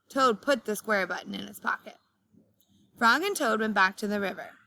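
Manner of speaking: ordinary talk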